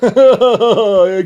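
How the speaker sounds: high, loud put-on mocking laugh. Enemies jeering at the narrator, loud mocking laugh. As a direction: cackle at your enemy. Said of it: "they're like".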